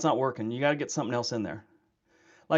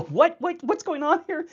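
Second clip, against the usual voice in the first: much higher, louder baffled cry; baffled what; 5 dB louder than usual. Retold or imitated. Hearing the bike story, a high baffled squeal. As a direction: squeal out the confusion. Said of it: "I'm all".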